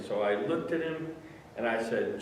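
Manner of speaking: ordinary talk, nothing marked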